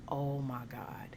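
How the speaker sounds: slow, soft near-whisper; hushed dismay. Retold or imitated. Driver stuck at the payment booth, hushed dismay. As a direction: whisper it slowly, as if mortified. Mortified at being unable to pay.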